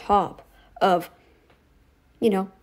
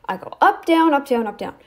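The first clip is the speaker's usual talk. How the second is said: "up, down" in a lively rise and fall, sinking at the end; inflection demo; 3 semitones higher than usual. Shown as a demonstration. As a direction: swing the pitch on each "up, down". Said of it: "like, I have inflection".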